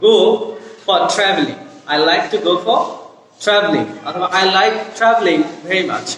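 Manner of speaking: ordinary talk, nothing marked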